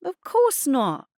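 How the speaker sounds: high, drawn-out smug denial. A narrator's reading. Adnan denying he read the diary, smugly. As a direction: deny it with a mocking smile. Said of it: "he smiled smugly".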